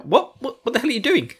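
high, alarmed what-the-hell; mock shock at being caught; startled. Rand startled at Selene rummaging, high alarmed. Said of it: "he's just like".

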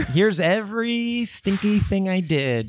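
mock it in a sing-song. Mocking Moses.

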